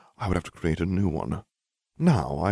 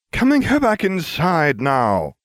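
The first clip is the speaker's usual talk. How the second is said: whining; 11 semitones higher, 7 dB louder, slower than usual. A narrator's reading. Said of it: "I whined".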